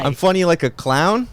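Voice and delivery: high, loud mock threat; mock menace. Friend quoting the gangster's threat, mock menacing. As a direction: toss the line back playfully. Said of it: "quoting Pesci's famous line".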